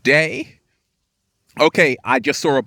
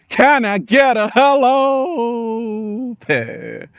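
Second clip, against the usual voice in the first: high, stretched-out call, holding "hello there" long; rousing call-out; 6 semitones higher, much slower than usual. Pretend. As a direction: call to a crowd for a response, slow and high. Calling out to the audience.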